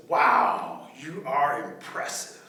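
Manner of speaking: slow, drawn-out admiration; stretched-out, impressed praise; mock awe. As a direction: flatter with an exaggerated wow. Rabbit flatters the snake.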